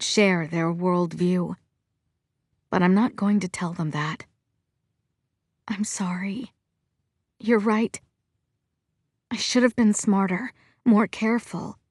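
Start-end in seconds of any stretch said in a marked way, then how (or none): 5.7-6.5 soft unvoiced whisper; whispered apology. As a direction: breathe the apology out. Apologizing to parents.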